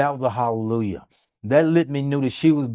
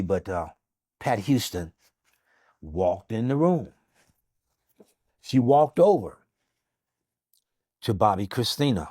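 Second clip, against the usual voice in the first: usual speaking voice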